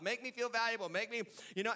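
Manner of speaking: ordinary talk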